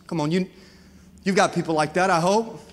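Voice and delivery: ordinary delivery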